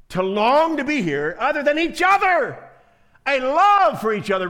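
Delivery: fervent sermon climax; preaching at full force. Acted. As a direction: drive the point home to the congregation.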